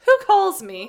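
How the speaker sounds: loud high call. Acted. Student searching for the voice, calling out loud. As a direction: shout up, puzzled. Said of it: "cried out".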